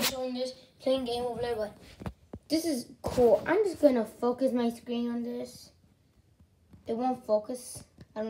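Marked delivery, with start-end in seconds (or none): none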